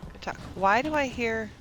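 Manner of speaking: ordinary talk, nothing marked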